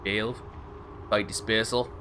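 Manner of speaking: softly testing an Australian accent; low, quiet Australian practice words; Australian accent warm-up. Shown as a demonstration. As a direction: feel out Australian vowels under your breath. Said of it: "but the wrong accent".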